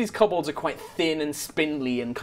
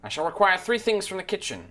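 princely kobold; 3 semitones higher, 4 dB quieter than usual. Acted. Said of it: "looks quite princely and regal".

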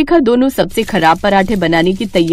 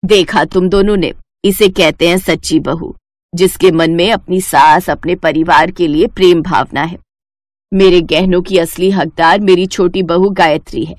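lower, measured mother-in-law voice; stern mother-in-law; 5 semitones lower than usual. Acted. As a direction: speak as the wise old mother-in-law, firm and knowing. Mother-in-law praises the youngest. (धीमी, गंभीर सास की आवाज़)

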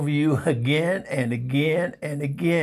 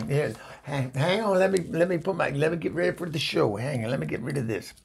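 normal talking voice

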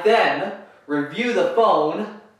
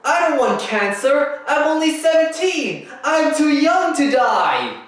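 trembling whiny yelps; 11 semitones higher than usual. Pretend. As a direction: wail like a scared kid. Fake panic over cancer warning.

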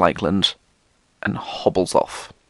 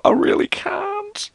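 pleading; slower than usual. Retold or imitated. Pleading refusal to vendor.

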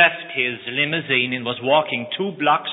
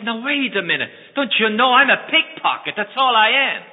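much higher, urgent; scared pickpocket, far higher and louder; frightened protest; 9 semitones higher, 4 dB louder than usual. Acted. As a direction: push back in alarm.

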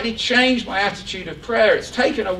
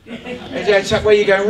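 normal talking voice

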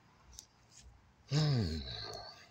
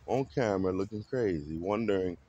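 normal talking voice

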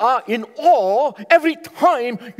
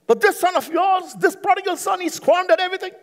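put-on angry older son; 11 semitones higher than usual. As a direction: spit out the brother's sins in high indignation. Older son's angry complaint voiced.

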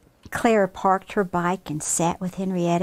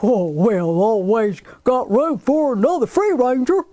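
hearty, folksy farmer voice with a chuckle; jolly farmer; 3 dB louder than usual. Acted. Farmer Barney welcoming Henrietta, jolly and hearty. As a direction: welcome them like a big-hearted old farmer.

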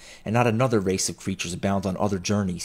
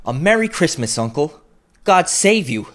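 high, loud, merry greeting; cheerful cry; 8 semitones higher, 7 dB louder than usual. A narrator's reading. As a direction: ring it out cheerfully to greet uncle. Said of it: "cried a cheerful voice".

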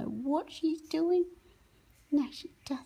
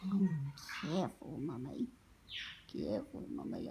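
much lower, softer play voice for the bird; parrot's voice; 11 semitones lower, 6 dB quieter than usual. Pretend. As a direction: speak as the bird warning mum. Voicing the parrot's protest.

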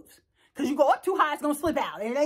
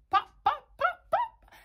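high playful "pop, pop" in rhythm; rhythmic pop sounds. Shown as a demonstration. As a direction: pop each word like a bounce. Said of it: "Let me show you".

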